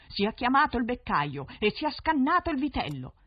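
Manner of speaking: high, loud royal command; shouted order. Acted. Prince to his servants, shouting the order. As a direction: bark the order to the court. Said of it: "Il re subito gridò".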